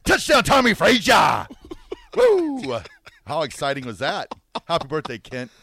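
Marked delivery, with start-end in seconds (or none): 0.0-1.5 loud, high touchdown call; announcer shout. Retold or imitated. Imitating famous touchdown call. 2.2-2.8 high, loud whoop; cheering woo. Real, not acted. Cheering after the call.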